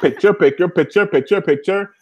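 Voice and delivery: imitating frantic photographer. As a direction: mimic nonstop picture-taking.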